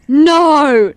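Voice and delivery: high loud shouted no; loud fake protest; mock yell. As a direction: shout it at nobody.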